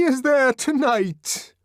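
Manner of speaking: high, loud pleading; pleading shout through the door; near-tearful shout. A narrator's reading. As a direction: shout it close to tears. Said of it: "Bernard was almost in tears".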